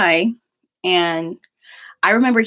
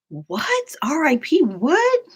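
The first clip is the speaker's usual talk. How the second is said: higher, breathless shock; shocked disbelief; 10 semitones higher, much slower than usual. Retold or imitated. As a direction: react in disbelief at the news. Shocked at the death post.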